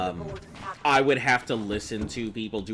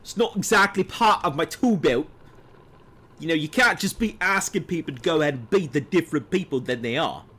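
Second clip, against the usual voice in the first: higher, louder mock British accent; put-on British accent; 7 semitones higher, 7 dB louder than usual. American streamer protesting a request to be British, in a put-on British accent. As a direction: play an American badly pretending to be a Brit, pitched up. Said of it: "be British for, like, two sentences".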